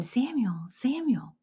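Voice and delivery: hushed, breathy calling of a name; a soft whispered summons; God's soft call. Acted. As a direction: call his name as if from the dark. God calling the boy Samuel.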